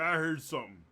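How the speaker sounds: lightly voiced hobgoblin on alert; a touch of monster alarm. Game master voices the hobgoblin.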